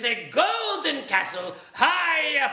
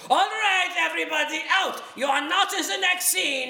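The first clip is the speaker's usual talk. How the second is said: shooing shout; 8 semitones higher, faster than usual. Acted. Narrator shooing actors away.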